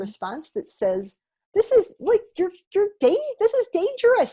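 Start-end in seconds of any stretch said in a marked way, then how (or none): 1.5-4.3 high, loud alarm-bell voice of the brain; alarmed inner voice. Pretend. Voicing the body's fear response warning, high and urgent.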